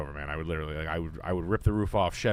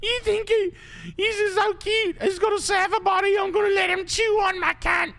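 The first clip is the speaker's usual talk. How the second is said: much higher, louder gushing falsetto of an American woman swooning over an Aussie; 7 dB louder than usual. Retold or imitated. Mocking American women drooling over an Australian nurse, high gushing falsetto. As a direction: squeal it like a starstruck American girl, Australian accent and all.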